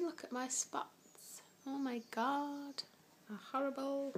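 breathy whispered aside. Real, not acted. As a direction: whisper it to the camera.

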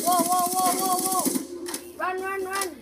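panicked high whoas; being chased. Real, not acted. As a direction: flee in loud panic.

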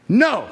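single loud, punched "No"; "No" as the loudest word; emphatic NO. Shown as a demonstration. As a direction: hit "No" hard, standing alone. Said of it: "That's the loudest word in this sentence".

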